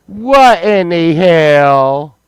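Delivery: what in the hell stretched slow and loud; slow drawn-out disbelief. Shown as a demonstration. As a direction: draw out every word in disbelief. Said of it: "repeats slower, drawn out".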